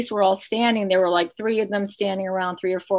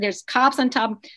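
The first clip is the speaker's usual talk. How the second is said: normal talking voice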